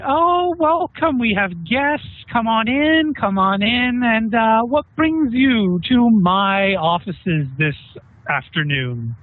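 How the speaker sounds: high, drawn-out arrogant welcome; arrogant, very confident. Acted. Hidden host welcomes intruding party into his offices, arrogant and confident. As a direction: greet them with lofty, supremely confident ease. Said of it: "arrogant with tone of voice".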